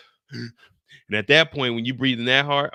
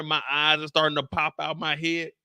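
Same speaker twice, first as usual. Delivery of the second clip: ordinary talk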